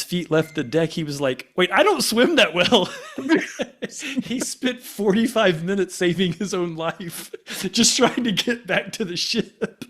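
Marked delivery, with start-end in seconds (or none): 1.6-2.9 high, louder mock alarm through laughter; high-pitched comic dawning alarm, half laughing; retold sudden realization. Retold sudden realization mid-jump. 3.2-9.8 high-pitched, cracking up through the story; helpless laughter between and under the words; laughing while talking. Real, not acted. Cracking up telling friend's story.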